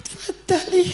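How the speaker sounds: very high, very soft squeak; barely audible bride. Acted. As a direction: shrink into a tiny timid voice. Shy bride even quieter.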